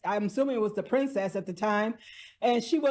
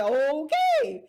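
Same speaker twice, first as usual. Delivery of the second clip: sarcastic okay; 6 semitones higher than usual. Retold or imitated. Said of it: "and I'm like".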